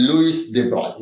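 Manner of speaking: Louis de Broglie said as "LOO-ee duh BROY"; Louis de Broglie ending in an "oy" sound; original name. Shown as a demonstration. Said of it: "His original name is".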